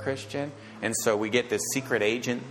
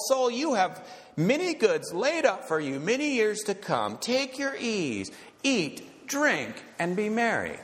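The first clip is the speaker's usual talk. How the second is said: rich man's boast; 7 semitones higher than usual. Acted. Rich man telling his own soul to relax and enjoy himself, smugly. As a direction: gloat over the easy life ahead.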